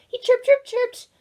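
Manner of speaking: high, loud, urgent; frantic chirping. A narrator's reading. Storyteller showing Oliver's panic, high and loud. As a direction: chirp it out in alarm.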